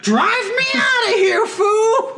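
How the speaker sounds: very high, loud cracking shout; mock frantic shout. Gang member after police cars explode, frantic high shout. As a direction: scream the order at full pitch. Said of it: "And he's like".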